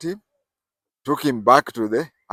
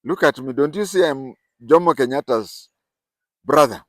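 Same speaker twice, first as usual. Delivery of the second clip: loud, pointed challenge; mock angry claim; indignant boast; 4 semitones higher, 6 dB louder than usual. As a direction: throw the famous brother in his face. Angry confrontation retold.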